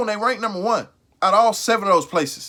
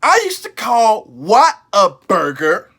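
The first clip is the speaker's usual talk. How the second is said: ordinary talk, nothing marked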